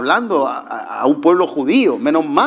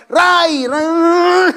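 high, loud call to a dog; 3 dB louder than usual. Retold or imitated. Preacher calling his dog Ray by name. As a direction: shout the dog's name across the yard.

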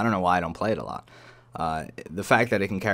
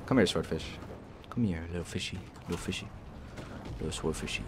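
mock coaxing; 3 semitones lower, 10 dB quieter than usual. Coaxing the enemy plane.

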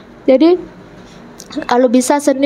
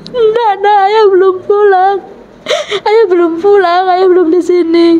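lightly acted mother, pitched up; mother's denial; 5 semitones higher than usual. Mother telling child that father is not home, lightly acted high denial. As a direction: gently raise the voice in a retold denial.

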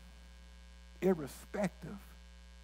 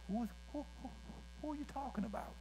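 higher, softer, baffled asking; mock puzzled; 9 semitones higher, 9 dB quieter, faster than usual. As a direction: play the puzzled listener who has never heard the name. Kenyans puzzled by American names.

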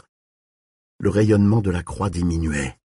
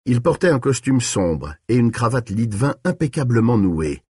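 ordinary delivery, nothing marked

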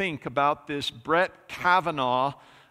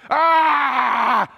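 high, loud scream before hanging up; frustrated scream; 8 semitones higher, 9 dB louder than usual. Retold or imitated. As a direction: blast out a caller's frustrated yell. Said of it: "he just goes".

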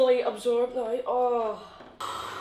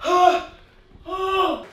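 yelping from the freezing water; in ice cold water; 2 semitones higher, 6 dB louder, slower than usual. Real, not acted. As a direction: cry out at the cold shock. First steps into ice bath.

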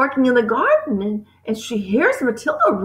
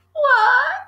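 high, pleading single word; upset girl's high wail; anguished cry; 11 semitones higher, 3 dB louder than usual. A narrator's reading. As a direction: cry out for an answer. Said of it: "Matilda cried".

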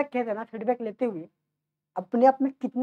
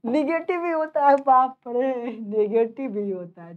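mock astonishment; 3 semitones higher, 5 dB louder, slower than usual. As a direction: feign amazement at a student's answer. Feigned astonishment at students' answer. (बनावटी हैरानी)

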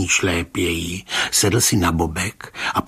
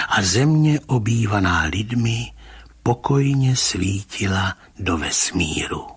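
ordinary delivery, nothing marked

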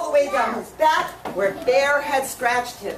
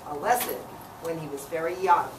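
plain reading voice

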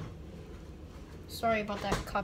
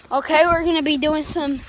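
on a trampoline; 4 semitones higher, 9 dB louder than usual. Real, not acted. Bouncing on a trampoline.

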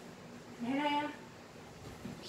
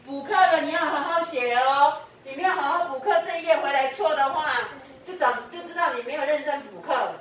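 usual speaking voice

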